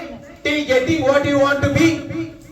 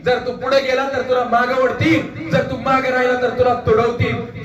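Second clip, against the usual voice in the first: ordinary talk, nothing marked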